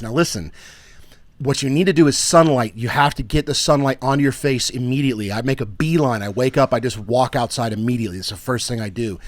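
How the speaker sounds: usual speaking voice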